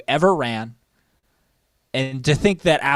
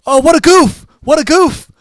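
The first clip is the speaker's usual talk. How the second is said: high, loud mocking wrestler voice; mock Arn Anderson; 12 semitones higher, 10 dB louder than usual. Retelling Arn's promo to his co-host, high loud mock voice. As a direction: squeal it out, poking fun at himself. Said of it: "Arn goes".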